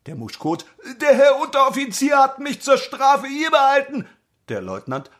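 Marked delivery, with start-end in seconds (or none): 1.0-4.1 laughing recruit. Acted.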